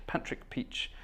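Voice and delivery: breathy whisper, savouring Patrick Peach; whispering Patrick Peach like a storybook character; whispered name. Real, not acted.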